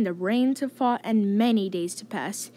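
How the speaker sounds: ordinary delivery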